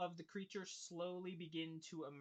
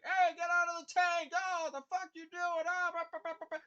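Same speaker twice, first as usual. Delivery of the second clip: high, loud mocking heckles; jeering crowd; 12 semitones higher, 9 dB louder than usual. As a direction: jeer at them like an angry mob. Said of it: "there's a lot of jeering".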